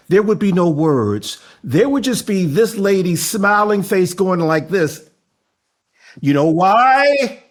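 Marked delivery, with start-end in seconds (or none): 6.2-7.4 louder, slow build-up; teasing hype question. Acted. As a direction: drag it out for suspense. Host hypes the guest.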